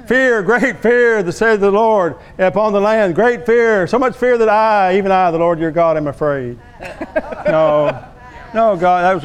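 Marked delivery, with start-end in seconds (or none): 0.1-6.6 mock prophecy. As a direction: imitate a self-styled prophet proclaiming doom. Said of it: "heard a prophecy, a wrong prophecy".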